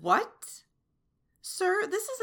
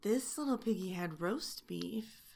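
put-on soft, sing-song rhyme that turns the children's verse into a taunt; teasing nursery rhyme; 2 semitones lower, 5 dB quieter than usual. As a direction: recite it like a bedtime rhyme with a smirk.

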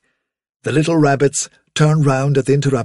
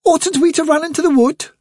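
very high little rabbit voice; tiny, high-pitched youngster; young rabbit; 3 dB louder than usual. A narrator's reading. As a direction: pipe up like a worried bunny child. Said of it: "said one of the young rabbits".

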